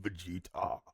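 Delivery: low, hushed villain voice; villain impression. Imitating Cell's voice in mockery.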